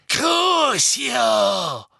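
high, loud, drawn-out spell cry; shouted curse. A narrator's reading. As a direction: hurl the torture curse with relish. Bellatrix casts torture curse.

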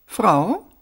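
high, questioning cool retort. A narrator's reading. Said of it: "erwiderte sie kühl".

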